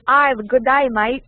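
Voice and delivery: broad mock-Australian greeting; put-on Aussie accent. Shown as a demonstration. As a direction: show off an Australian accent, vowels wide. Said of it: "have you heard an Australian accent?".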